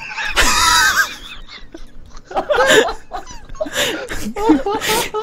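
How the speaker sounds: long high crying laughter; hysterical laugh. Real, not acted. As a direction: laugh until breathless.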